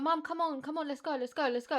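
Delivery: high, urgent come on; panicked nagging of mum; urgent hurrying. Retold or imitated. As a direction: hurry her along, pressing. Rushing mum to the gate.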